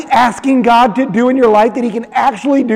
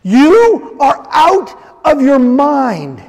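slow, mocking dismissal; slow, lightly mocking scoff; scoffing disbelief; 3 semitones higher, much slower than usual. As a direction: drag it out like they think she's lost it. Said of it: "Listen to this response".

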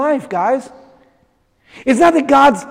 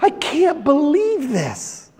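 disbelief; 4 semitones higher, 6 dB quieter, much slower than usual. Retold or imitated. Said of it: "you're like".

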